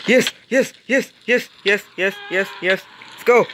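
high, staccato cheering; excited celebration. Real, not acted. Player celebrating a success, excited cheering. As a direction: rattle off short high bursts of triumph.

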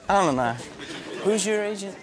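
ordinary talk, nothing marked

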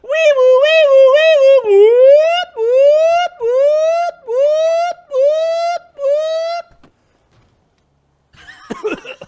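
loud, high wailing wee woo siren; siren imitation. Showing off his car, a loud high siren imitation. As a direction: belt it out like a police siren.

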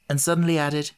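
usual speaking voice

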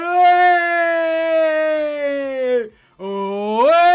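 high, loud call to clear it; loud shout. Real, not acted. Fan yelling at his defence to clear the ball.

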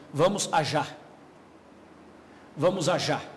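"Vamos ajar" with the ll said as zh, a-zhar; Buenos Aires pronunciation. Shown as a demonstration. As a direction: say "ajar" the porteño way. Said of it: "Eles falam assim".

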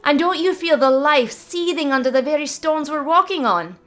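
put-on high, loud teasing girl's voice; cheeky young druid. Mavis mocking the wizard's popularity, high teasing. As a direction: needle the old man playfully.

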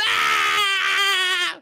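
long panicked scream. Real, not acted. Boy fleeing the tiger in water, long girlish scream. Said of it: "Did you guys hear that scream?".

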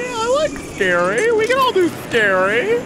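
camp it up like a ghost story; mock spooky. Mock spooky voice while gaming.

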